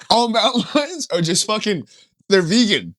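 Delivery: ordinary talk, nothing marked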